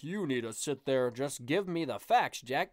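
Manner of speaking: mock stuffy know-it-all, slowing down; lightly put-on pompous demand; pretentious guy. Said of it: "the pretentious guy who's like".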